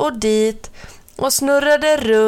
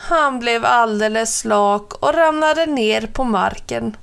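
plain reading voice

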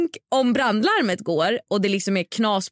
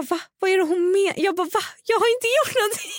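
high, half-laughing panicked protest; panicked disbelief; 9 semitones higher than usual. Retold or imitated. Reading fake rumours about her, high panicked laughing protest. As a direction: protest your innocence in a high-pitched panic.

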